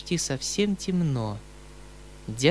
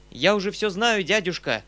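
raised, eager interjection; louder, higher interruption; interrupting cry; 6 semitones higher, 4 dB louder than usual. A narrator's reading. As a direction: cut in with a cry. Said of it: "вскричал я, перебивая его".

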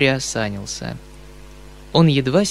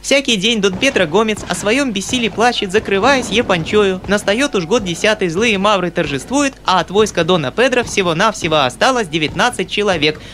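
put-on small, clear, high little boy's voice; even singsong schoolboy recital; child's rote recitation; 7 semitones higher, 3 dB louder than usual. A narrator's reading. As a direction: rattle off the verses without commas or stops. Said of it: "ровным и ясным голосом, без запятых и без точек".

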